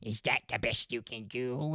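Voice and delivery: a light imitation of a squawky cartoon duck; Donald Duck voice. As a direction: quack the line out like a cartoon duck. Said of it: "he does indeed sound like Donald Duck".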